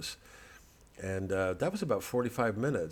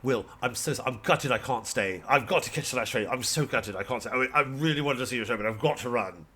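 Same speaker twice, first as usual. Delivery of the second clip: louder, gushing British apologetic voice; imitating British openers; 3 semitones higher, 5 dB louder than usual. The opener apologizing to the headliner, gushing British. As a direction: gush apologies in a put-on English accent. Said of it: "they said".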